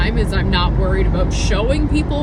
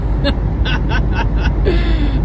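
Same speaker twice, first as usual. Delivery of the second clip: real high-pitched burst of laughter; laughing; 6 semitones higher than usual. Real, not acted. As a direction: break into a run of high giggles. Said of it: "I'm laughing".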